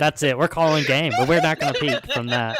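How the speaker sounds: loud laughing exclamation; laughing loudly. Real, not acted. GM laughing, calling game.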